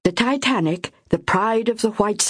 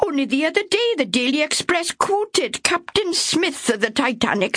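high, firm, dismissive of danger; stubborn reassurance; 9 semitones higher than usual. Acted. Ethel brushing off Myra's fears, stubborn. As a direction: she trusts the newspaper completely.

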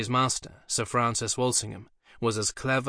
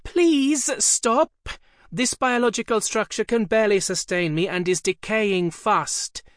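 much higher, louder desperate pleading; pained scream; 10 semitones higher, 5 dB louder than usual. A narrator's reading. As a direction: beg in agony to stop. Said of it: "which screamed like a woman in childbirth".

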